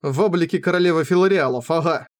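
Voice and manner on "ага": ordinary delivery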